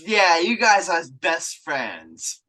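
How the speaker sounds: slow, drawn-out mock sincerity; exaggerated, dragged-out agreement; sarcastic agreement. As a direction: lay the sarcasm on thick, stretching the words. Sarcastic agreement, followed by Not.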